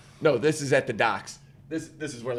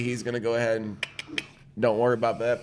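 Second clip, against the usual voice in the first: ordinary talk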